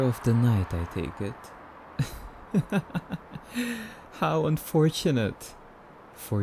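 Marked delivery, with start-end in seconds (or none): 2.5-3.9 soft, high airy vampire chuckle; a quiet laugh of lofty amusement; light chuckle at the stranger; amused laugh. Acted.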